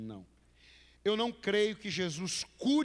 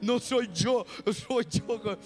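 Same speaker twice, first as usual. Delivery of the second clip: ad catchphrase; 4 semitones higher, 3 dB louder, slower than usual. Retold or imitated. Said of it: "aquela propaganda".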